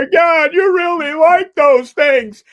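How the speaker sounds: high, loud, slow mock host gushing; host voiced. Mocking the host's amazement at the meatball feat.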